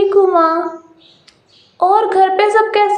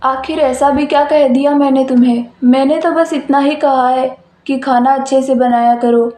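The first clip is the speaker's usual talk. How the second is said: lowered male voice, defensive; low voice, puzzled at her anger; husband's voice; 3 semitones lower, faster than usual. Acted. Husband asks why his wife is upset, lowered male voice.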